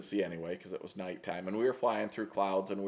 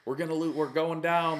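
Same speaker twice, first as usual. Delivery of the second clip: high, louder mock panic; freaked-out panic; 8 semitones higher, 4 dB louder than usual. Pilot panicking over the missing fuel cap, mock freak-out. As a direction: retell his panic in a raised, urgent voice. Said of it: "he freaked out".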